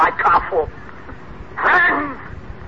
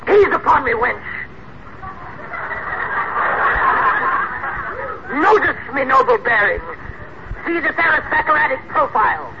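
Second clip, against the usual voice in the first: lordly grandeur; 7 semitones higher than usual. Acted. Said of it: "you sound so, uh, so regal".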